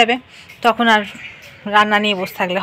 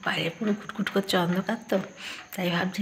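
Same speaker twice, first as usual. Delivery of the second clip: early morning whisper; 10 dB quieter than usual. Real, not acted. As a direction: whisper so nobody wakes. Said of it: "আস্তে আস্তে কথা বলছি".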